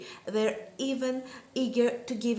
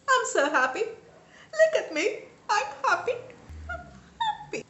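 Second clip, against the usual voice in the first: overjoyed; 11 semitones higher than usual. Retold or imitated. Reader relives the happy ending, squealing with laughter. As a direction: gush with delight. Reenacting joyful reading reaction.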